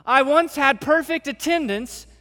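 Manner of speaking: high, loud, self-important ask to Jesus; a pushy plea that expects credit; boastful mock plea. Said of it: "He doesn't say".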